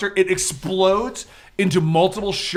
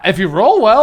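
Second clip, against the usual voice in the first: ordinary talk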